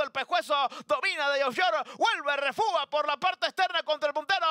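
building from brisk call to shouted finish; horse race commentator. Real, not acted. Race finish, caller builds to climax.

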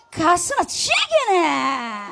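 slow, careful 가스나 지기네 in Busan dialect, drawing out 지기네; slow Busan imitation. Shown as a demonstration. Singer slowly repeating the Busan praise she just heard. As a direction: savour each syllable of 가스나 지기네 Busan style. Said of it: "이 말이 최고인 것 같습니다".

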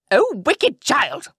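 high, loud, slow furious witch; witch crying out. A narrator's reading. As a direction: spit it out as the enraged witch. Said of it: "cried the witch".

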